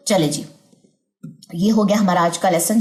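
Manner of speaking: usual speaking voice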